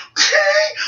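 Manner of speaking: very high retold squeal; mock straining at the last second; high strained squeal. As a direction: squeeze the word out high. Retold effort at last moment.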